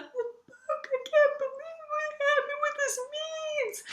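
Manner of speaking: high, wailing sob-voice, drawn out at the end; overwhelmed reader wailing through tears; imitating sobbing. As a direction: replay the ugly cry, squeaky and breaking. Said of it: "I'm just crying".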